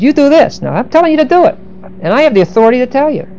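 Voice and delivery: mock-authoritative, insistent high pitch; commanding. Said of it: "he just got through commanding them".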